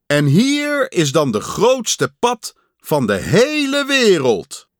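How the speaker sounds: high, loud stage announcer; big showman's introduction, pitched high; strange distant announcer. A narrator's reading. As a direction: announce the star grandly from afar. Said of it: "Ver weg zei een vreemde stem".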